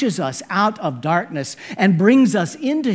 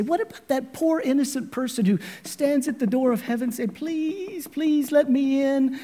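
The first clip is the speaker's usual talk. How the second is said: lightly voiced pleading soul, higher; pleading innocent; 10 semitones higher than usual. Poor soul at heaven's gate, mild mock pleading. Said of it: "we say".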